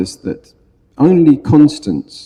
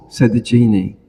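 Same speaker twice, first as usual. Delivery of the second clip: ordinary talk, nothing marked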